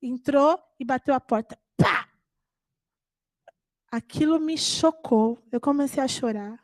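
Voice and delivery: ordinary delivery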